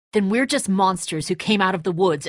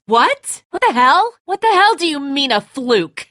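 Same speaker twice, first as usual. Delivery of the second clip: loud, high, furious yelling; angry shout; 8 semitones higher, 6 dB louder than usual. Acted. Father yelling at Lee in disbelief, angry shout. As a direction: blow up in disbelief and rage.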